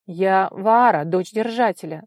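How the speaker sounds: loud, firm declaration; declaring. A narrator's reading. Vara names herself to the stranger, loudly declaring. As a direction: announce it with full volume. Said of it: "заявила она".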